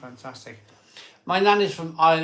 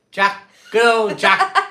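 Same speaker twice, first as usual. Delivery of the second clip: hammed-up Irish accent; 8 semitones higher, 10 dB louder, much slower than usual. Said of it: "[name], you gonna have a go of an Irish accent?".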